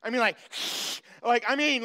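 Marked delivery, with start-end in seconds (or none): 0.4-1.0 imitated animal hiss; possum hiss. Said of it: "he starts hissing at me".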